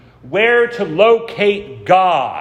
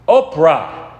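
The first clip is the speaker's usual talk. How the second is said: "Oprah" from the throat, a hint of accent; lightly voiced throaty mispronunciation of "Oprah"; 4 dB louder than usual. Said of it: "with his throaty voice".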